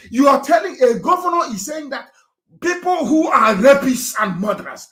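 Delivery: hurling the accusation at the audience, fired up; outraged shout. Real, not acted. Activist to his followers about the governor, outraged shout.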